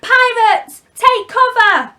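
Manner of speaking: high, loud alarm shout; urgent yell. Acted. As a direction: warn everyone to hide now. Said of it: "Peter yelled".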